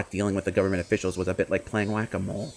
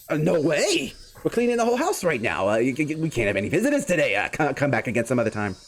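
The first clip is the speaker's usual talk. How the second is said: higher, nervous stammering guardian voice; flustered guardian; 4 semitones higher than usual. Acted. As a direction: stammer him off the phone, rattled. Flustered guardian fends off caller.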